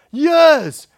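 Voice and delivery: loud and falling, hammer the word Years; emphatic loud. Real, not acted. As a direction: let Years land hard and loud. Preacher stresses long therapy.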